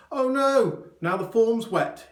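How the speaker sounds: high, loud flustered cry; alarmed exclamation. A narrator's reading. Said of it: "he exclaimed".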